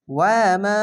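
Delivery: normal talking voice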